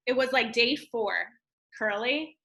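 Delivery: ordinary delivery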